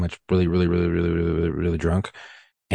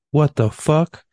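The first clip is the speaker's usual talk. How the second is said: high, louder rising question; startled, incredulous reenactment; retold shocked disbelief; 7 semitones higher, 5 dB louder, much slower than usual. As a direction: pitch it up in mock shock. Retells reaction to skinwalker video.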